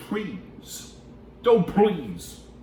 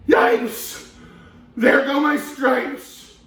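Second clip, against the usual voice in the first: loud, higher, slow wail of dismay; zebra yelling; 13 semitones higher, 9 dB louder, much slower than usual. Acted. Zebra loses its stripes, a loud high yell of alarm. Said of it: "and the zebra yelled".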